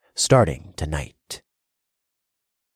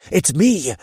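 loud urgent growl; 11 semitones higher, 6 dB louder than usual. Acted. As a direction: growl it close to her ear. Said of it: "I growled into her ear".

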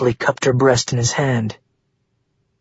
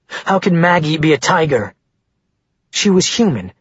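plain reading voice

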